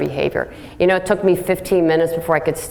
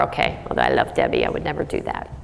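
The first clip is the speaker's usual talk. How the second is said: much lower, near-whispered confession; hushed aside; 5 dB quieter, faster than usual. Real, not acted. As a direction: drop to a secret aside. Confiding aside to audience.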